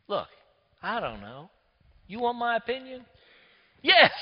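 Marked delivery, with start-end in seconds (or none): 3.8-4.1 sudden high, loud yes; eager yes. Retold or imitated. Preacher voices eager Leon wanting his opinion, an excited high yes.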